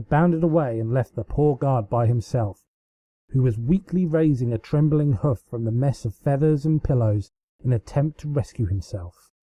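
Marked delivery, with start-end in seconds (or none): none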